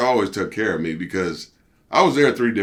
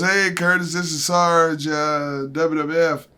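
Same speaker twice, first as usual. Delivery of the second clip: raised, louder mock phone voice of Sarge; imitating Sarge; 7 semitones higher, 3 dB louder, slower than usual. Sarge leaving a phone message for Curtis, imitated higher and louder.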